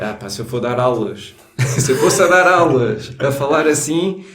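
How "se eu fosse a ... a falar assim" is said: laughing. Real, not acted. Laughing about accent in class.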